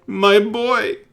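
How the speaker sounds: high, loud anguished cry; grieving father. Acted. Father telling the party about his son, anguished. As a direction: cry out the loss.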